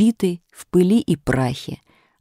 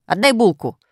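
higher, bossy order; mildly shouted command; shouted demand; 6 semitones higher than usual. A narrator's reading. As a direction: demand it like a little bully. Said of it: "крикнул Серёжка".

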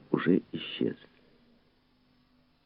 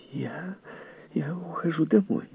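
high, soft, shaken lady; timid announcement, pitched up and quiet; trembling voice; 12 semitones higher, 6 dB quieter than usual. A narrator's reading. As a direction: falter softly in a high, trembling voice. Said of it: "дрожащим голосом промолвила".

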